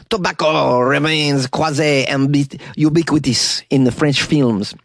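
slow mock French accent on Tobacco remains quasi-ubiquitous. Shown as a demonstration. Host trying a slow French accent on the quote. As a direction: drawl it like a Frenchman, slowly. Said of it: "I don't know if that's a good French accent".

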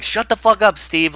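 mock angry yell. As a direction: bark it at the hapless assistant, hammed up. Quoting Gosling berating his assistant.

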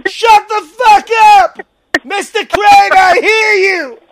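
high, slow, furious yelling; angry shout. Retold or imitated. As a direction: yell back at the intercom. Imitating Sam yelling at pages.

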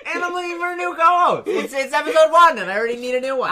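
high, loud rant through laughter; laughing mock outrage. Host mock-firing the co-host in episode one, laughing outrage. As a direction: wail it while cracking up.

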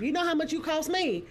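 high-pitched mock parental complaint; exasperated parent. Parent reminding child of the cost of raising them, exasperated.

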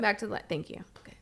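real quick whisper; hushed aside. Real, not acted. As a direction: stay quiet near the dog. Whisper while dog settles.

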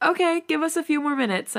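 high-pitched call to another room; calling back. A narrator's reading. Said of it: "I call back".

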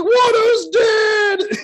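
high, slow drawn-out cry; excited shout. Pretend. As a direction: stretch out a gleeful yell. Mock cheering at a death.

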